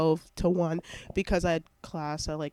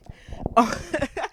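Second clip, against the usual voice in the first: laughing; 7 semitones higher than usual. Real, not acted. Laughing at own word.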